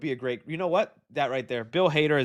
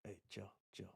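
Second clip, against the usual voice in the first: whispered hush; 7 semitones lower, 25 dB quieter than usual. Real, not acted. Hushing someone off mic.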